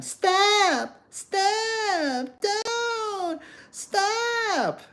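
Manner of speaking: high, shrill-free falsetto cries of stop; mock shrieking woman. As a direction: mimic a panicking woman yelling at a fight. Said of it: "are always shouting".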